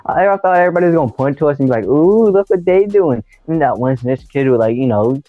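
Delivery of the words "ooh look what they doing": high, slow sing-song "ooh"; teasing kids. Retold or imitated. Classmates teasing the young couple, sing-song and slow. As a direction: draw it out like schoolkids teasing a couple.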